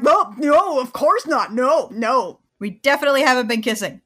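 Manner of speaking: hammed-up nervous no no no; flustered denial. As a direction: deny it in a panic. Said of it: "And he's like".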